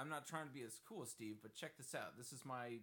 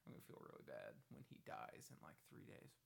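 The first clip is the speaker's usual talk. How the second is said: guilty muttered aside; whispered under breath; 9 dB quieter than usual. Real, not acted. Guilty aside about Charlie Sheen.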